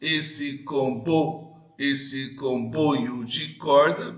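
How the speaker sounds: "esse comboio de corda" very slow, syllable by syllable; stress on the fourth syllable. Shown as a demonstration. Teacher to class, scanning the verse very slowly. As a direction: draw out "esse comboio de corda" and mark the beat. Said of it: "quarta sílaba".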